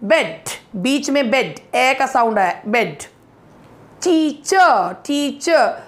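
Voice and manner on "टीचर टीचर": टीचर said as "tee-chuh"; टीचर with long ee and silent R; final R as schwa. Shown as a demonstration. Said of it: "र अगर last में आता है तो भी वो अ बन जाता है".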